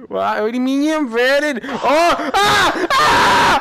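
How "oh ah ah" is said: high screams building louder; laughing shrieks of alarm. Real, not acted. Player suddenly flipped over, screaming.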